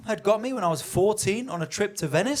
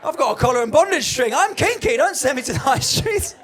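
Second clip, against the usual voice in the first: loud, higher mock-offended rant; indignant outburst; 6 semitones higher, 7 dB louder than usual. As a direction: huff at him like your kink was insulted. Retold indignant retort.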